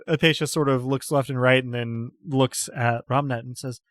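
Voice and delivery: ordinary talk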